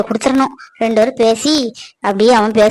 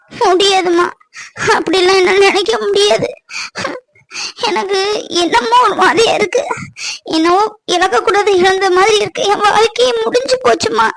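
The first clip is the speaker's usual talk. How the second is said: high, tearful voice near breaking; grieving daughter; 7 semitones higher than usual. Acted. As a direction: cry out that life is over. Daughter grieves to her mother. (அம்மாவிடம் அழுது புலம்பும் மகள்)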